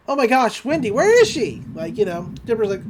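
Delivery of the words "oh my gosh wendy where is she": higher, louder mock-worried call; worried retelling. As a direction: act out alarm at someone missing. Searching for missing Wendy in retelling.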